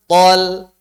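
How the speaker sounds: Tol said heavy and rounded; a loud, heavy Tol; thick tol. Shown as a demonstration. Teacher singles out the ending tol. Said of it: "Masih banyak orang yang baca".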